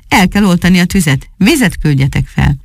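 ordinary delivery

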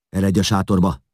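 loud, high shouted order; shouting. A narrator's reading. Father shouts at his son to go inside, loud. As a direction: call out sharply to your child. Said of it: "kiáltott a fiára".

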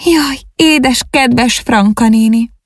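high, slow, tearful wail; keen it slowly through tears; sobbing lament. A narrator's reading. Young girl weeping for her lost aunt, high slow lament. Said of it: "zokogásba fakadt".

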